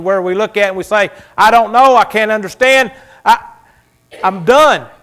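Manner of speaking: loud, high climax, breaking to despair; preaching at full force. Acted. Pastor to congregation, building to a loud cry. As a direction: shout the weariness out to the church.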